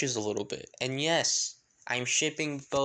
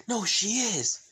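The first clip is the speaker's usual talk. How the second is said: high, loud contradiction; heated retort; 9 semitones higher, 5 dB louder, slower than usual. Acted. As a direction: blurt back in a squabble. Acted argument, tricked into blurting it.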